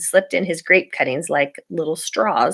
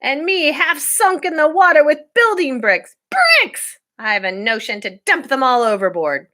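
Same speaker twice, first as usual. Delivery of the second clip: angry captain; 8 semitones higher than usual. Acted. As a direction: rant about the heavy cargo. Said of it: "roared Captain Swain".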